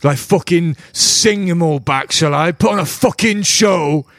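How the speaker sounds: high, slow scornful outburst; mock the suggestion with heated sarcasm; angry sarcasm. Desperate father scorning a performer's plea, angry sarcasm. Drovak mocks the performer's objection.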